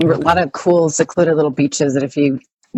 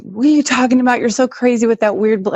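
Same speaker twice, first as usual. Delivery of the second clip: higher-pitched retold exasperation; imitated freaking out; 6 semitones higher than usual. Wife retells husband doubting her, lightly imitated panic. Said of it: "He's, like, freaking out".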